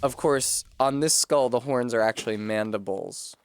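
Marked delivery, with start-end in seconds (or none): none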